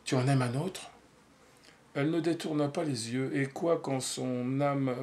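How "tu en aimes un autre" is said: a lightly voiced probing interrogator; understated suspicious questioning; inquisitor's tone. A narrator's reading. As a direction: press her like a mild inquisitor. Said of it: "d'un inquisitateur".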